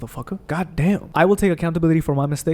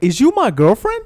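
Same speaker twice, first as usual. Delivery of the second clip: higher, louder disbelieving question; incredulous retort; 6 semitones higher, 3 dB louder than usual. Retold or imitated. Vlogger mocking friends over a cake, incredulous. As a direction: scoff at the absurd demand. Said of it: "It's like".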